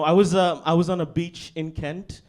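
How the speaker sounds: ordinary talk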